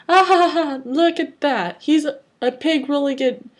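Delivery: high acted laugh into a jeer; mocking laughter. A narrator's reading. Bully Dagger over the child fallen in mud, high mocking laughter. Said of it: "Dagger laughed".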